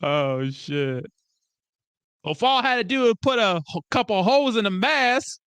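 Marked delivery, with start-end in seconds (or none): 2.2-5.4 high, put-on complaining voice; indignant movie character. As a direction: imitate an aggrieved character, pitched high.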